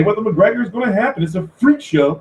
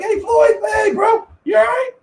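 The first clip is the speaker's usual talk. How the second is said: mock fussing referee. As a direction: squeal over the fighter like a fussing ref. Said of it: "Bayless be like".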